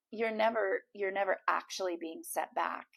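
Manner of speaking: ordinary delivery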